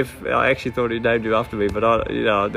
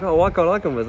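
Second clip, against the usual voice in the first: put-on higher, whiny kid voice; imitating his pleading son; 6 semitones higher than usual. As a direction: plead like a little boy wanting to tag along. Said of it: "and then he's like".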